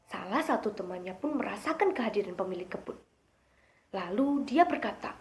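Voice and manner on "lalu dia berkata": ordinary delivery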